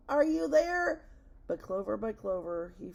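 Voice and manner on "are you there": loud, high searching call. A narrator's reading. Elephant searching the clover calls to his friends, calling. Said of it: "and called".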